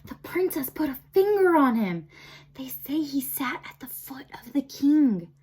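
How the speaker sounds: hushed unvoiced rumor-telling; gossip whisper. A narrator's reading. Crowd of mice whispering rumors about Despero. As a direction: pass the rumor along in a whisper.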